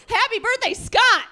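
high, loud, sarcastic "Happy birthday, Scott!"; mock birthday shout. Comic calling out to her ex on his birthday, mock cheer.

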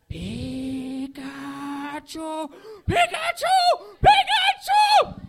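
squeaky, high, loud Pokemon cry; high cartoon Pikachu call, drawn out at the end. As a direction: squeal the name like a cartoon creature. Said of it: "arguing with Pikachu".

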